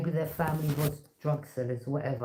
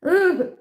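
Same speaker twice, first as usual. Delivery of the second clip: mock high ooh; 12 semitones higher, 9 dB louder than usual. Reacting to naive girls.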